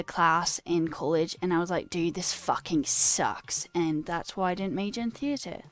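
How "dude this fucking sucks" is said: ordinary delivery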